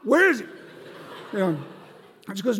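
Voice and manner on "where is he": high, loud, impatient cry with a laugh at the end; the friend demands to see the pastor, loud and urgent; urgent shout. Retold or imitated. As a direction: bark it out like a man on a mission. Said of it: "And he goes".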